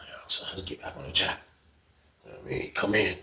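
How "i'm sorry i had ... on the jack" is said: fast, hushed apology under the breath; hurried whisper. Retold or imitated. Inmate whispering an excuse to his cellmate, fast and hushed. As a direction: whisper it quickly so nobody hears.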